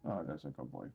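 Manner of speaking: low, soft pet praise; cooing at the cat. Real, not acted. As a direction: croon it tenderly to a purring cat.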